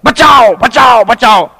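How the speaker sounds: shouted cries, pitched high; loud mock cry for rescue; calling for help. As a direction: yell for help like a crowd at a well. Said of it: "ठहर के पुकार रहे हैं".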